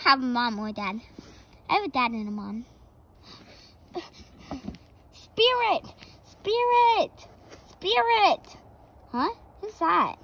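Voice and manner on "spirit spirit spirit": calling out. Acted. Dad calling from afar.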